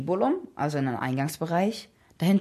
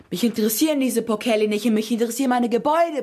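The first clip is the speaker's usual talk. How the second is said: loud, fast angry outburst, pitched up; bellowing; 5 semitones higher, 6 dB louder, faster than usual. A narrator's reading. Scipio cutting off his slave's bad news, bellowing. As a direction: roar impatiently, rushing the words. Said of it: "brüllte Scipio".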